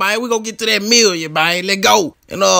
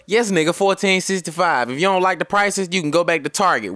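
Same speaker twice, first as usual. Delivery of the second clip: usual speaking voice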